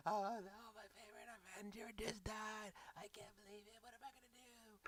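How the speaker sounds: high whimpering falsetto, trailing into a whisper; hammed-up grief of a weeping fan; mock crying. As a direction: wail it softly in a put-on high voice. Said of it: "Even the babies were crying".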